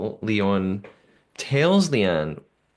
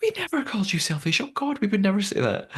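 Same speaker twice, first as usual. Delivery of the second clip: much higher-pitched imitation of protest; mock indignant denial; 11 semitones higher than usual. Recapping Leanne denying she called anyone selfish, mock indignant. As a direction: protest innocence in a high put-on voice.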